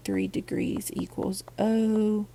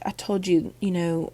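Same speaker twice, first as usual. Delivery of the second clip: ordinary talk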